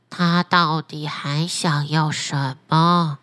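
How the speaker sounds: deep, slow magic fish; a low, unhurried talking fish; flounder voice. Acted. Magic flounder answering the fisherman, deep and slow.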